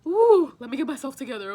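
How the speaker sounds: loud exhale, then pulling together; real struggle to hold back crying; composing herself. Real, not acted. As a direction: blow out the tears and steady yourself. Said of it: "we all cry sometimes".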